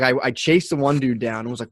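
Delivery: ordinary talk, nothing marked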